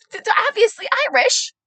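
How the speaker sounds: very high, loud mock insistence; indignant protest. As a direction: imitate mom's flustered comeback. Mother protests, imitated.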